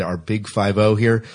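ordinary talk

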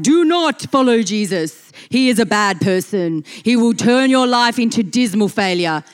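a hint of a cartoon villain giving orders; lightly voiced devil, hammed-up threat; blunt devil. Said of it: "He doesn't talk like".

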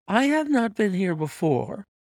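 high-pitched wifely denial. A narrator's reading. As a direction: protest in a high wife's voice. Wife denies it to husband.

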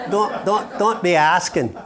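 high, laughing warning. Real, not acted. Preacher tells the congregation not to ask, laughing. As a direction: wave it off while laughing.

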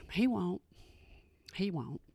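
low, sinking, resigned aside; quiet resignation. Real, not acted. Wife resigned to husband never moving his stuff, quietly defeated. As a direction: drop it softly after a sigh, giving up.

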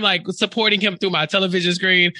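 ordinary talk

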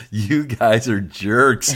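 mock accusation. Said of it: "and she goes".

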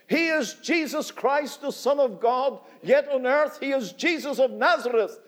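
building sermon climax, God and man; preaching at full force. Acted. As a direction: proclaim it to the whole hall.

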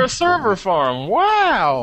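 high, delighted gush; excited awe. Real, not acted. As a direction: swoon over the server farm. Gushing over server farm photos.

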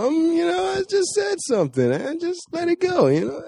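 put-on very high, slurred old man voice; Donald Sterling impression. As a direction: drunk Pauly Shore voice. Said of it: "sound like a drunk Pauly Shore".

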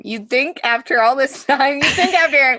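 fighting back real tears while laughing; real tearful laugh-cry; choked up. Real, not acted. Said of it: "I could say it without crying, but I still can't".

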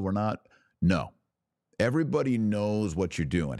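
ordinary delivery

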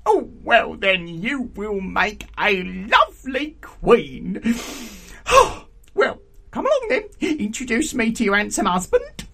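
put-on loud, high, gleeful old aunt; raucous, delighted auntie. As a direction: sweep the girl along with noisy approval.

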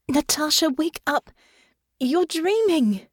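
high, insistent plea to wake; urgent waking call. A narrator's reading. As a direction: rouse a dreamer urgently in a high voice. Waking partner from a nightmare.

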